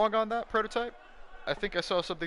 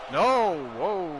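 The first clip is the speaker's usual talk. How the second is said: surprised shout; 5 semitones higher, 7 dB louder than usual. Real, not acted. Near fall reaction.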